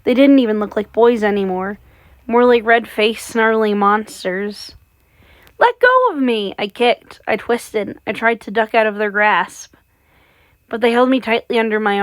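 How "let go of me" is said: put-on girl thrashing in the boys' grip; panicked cry. A narrator's reading. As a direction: shriek to be let go. Girl struggling against captors.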